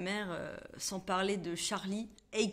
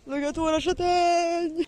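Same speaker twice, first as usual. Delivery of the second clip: excited; 8 semitones higher, 8 dB louder than usual. Acted. Repeats phrase high, slow, loud.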